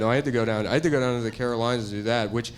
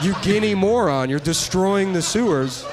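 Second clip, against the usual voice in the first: higher, louder scold that slows at the end; mock angry scolding; 5 semitones higher, 4 dB louder than usual. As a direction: berate the public like a fed-up official. Mock PSA scolding the public.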